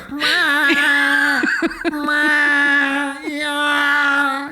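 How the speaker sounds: long, high, loud cat yowling; imitated cat yowls. Comic impersonating ornery cats mid-flight, drawn-out yowls. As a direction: yowl like an ornery cat in a carrier.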